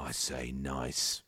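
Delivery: low, very slow Cockney; drawn-out deep Cockney answer; ponderous Cockney dragon; Cockney investor. Acted. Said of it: "Oh, he's Cockney".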